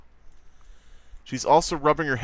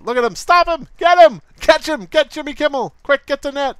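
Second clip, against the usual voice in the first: much higher, louder hammed-up panic; mock frantic chase; 14 semitones higher, 8 dB louder than usual. Streamer mock-yelling at chat to catch Jimmy Kimmel, hammed-up panic. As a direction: yell orders like chasing an escaped animal.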